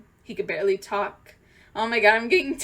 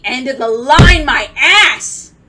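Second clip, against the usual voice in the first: much louder, higher indignant yell; angry outburst; 4 semitones higher, 14 dB louder, slower than usual. Real, not acted. Fan furious about Steve leaving Bucky, loud and high. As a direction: spit it out in outrage.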